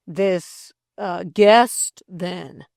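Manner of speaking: halting, suspicious question; frowning. A narrator's reading. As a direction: hesitate and lean hard on "guest". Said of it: "frowned Delcote".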